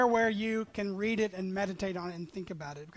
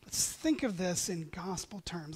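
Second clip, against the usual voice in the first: normal talking voice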